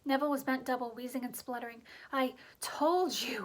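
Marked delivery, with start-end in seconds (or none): 2.1-3.5 slow, breathless gasping; winded words, gasping for air; gasped. A narrator's reading. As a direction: push each word out between gasps. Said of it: "Hermione gasped".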